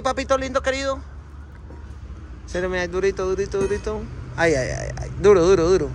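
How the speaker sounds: usual speaking voice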